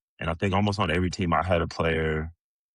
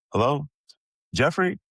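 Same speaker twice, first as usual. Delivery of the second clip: high, raised voice answering the phone; hamming up the coach's hello; imitating Coach Pop; 10 semitones higher, 4 dB louder than usual. The coach calls the player, in a high mock phone greeting. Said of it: "Yes, sir, Coach Pop".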